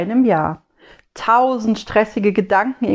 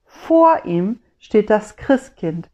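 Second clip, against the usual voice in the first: plain reading voice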